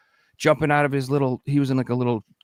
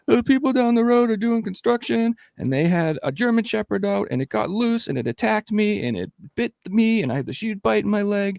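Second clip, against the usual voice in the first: girlfriend retold; 9 semitones higher than usual. Girlfriend's words, voiced higher.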